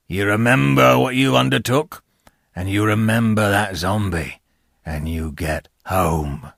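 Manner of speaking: a hint of a menacing convict; gruff convict. A narrator's reading. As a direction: send him off with a last threat. Convict sends boy home.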